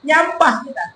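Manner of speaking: usual speaking voice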